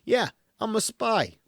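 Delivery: high, loud, smug "I'm a spy" brag; high, loud mock spy swagger; cocky fumbling spy. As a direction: play a bumbling wannabe James Bond showing off. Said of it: "a dude who's just like".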